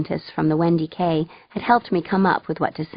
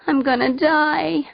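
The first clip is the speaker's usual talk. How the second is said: slow, higher mock-despairing moan; 9 semitones higher, slower than usual. A narrator's reading. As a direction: moan it out, dragging. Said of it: "I moaned".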